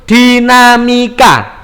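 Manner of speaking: slow, drawn-out "dinamika"; stretched key word, each syllable held; syllable-by-syllable term. Shown as a demonstration. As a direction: spell out "di-na-mi-ka" slowly for the class. Teacher introduces a key term.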